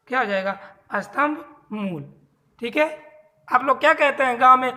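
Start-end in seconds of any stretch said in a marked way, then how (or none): 0.9-2.0 स्तंभ मूल drawn out slowly, falling at the end; slow emphatic answer. Shown as a demonstration. (स्तंभ मूल धीरे-धीरे, ज़ोर देकर)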